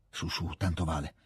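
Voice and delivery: very soft, low self-encouragement; private resolve. A narrator's reading. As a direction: talk yourself into it under your breath. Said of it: "pensò Petia". (incoraggiarsi piano, tra sé)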